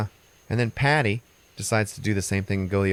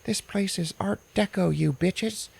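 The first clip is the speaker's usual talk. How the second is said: much higher, indignant little manager; a prissy boss scolding, pitched way up and slow at the end; fussy little manager; 14 semitones higher, slower than usual. Retold or imitated. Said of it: "the little manager guy is like".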